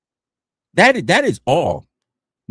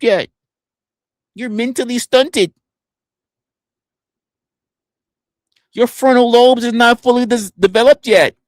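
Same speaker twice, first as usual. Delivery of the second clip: higher-pitched mock of their talk, getting louder; mimicking the critics; 9 semitones higher, 4 dB louder than usual. Streamer mimicking childish critics, high mocking voice. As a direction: build to a loud, high mocking imitation. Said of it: "That's how you sound".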